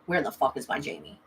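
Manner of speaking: low, soft self-questioning; retold quiet musing. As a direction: wonder it to yourself, softly. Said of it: "I started thinking".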